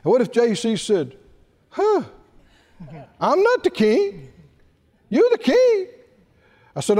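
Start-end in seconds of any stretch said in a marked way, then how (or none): 1.7-5.9 high-pitched mock disbelief; baffled protest. Employee answering the king's order, high baffled protest. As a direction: play a staffer balking at the order, pitched high.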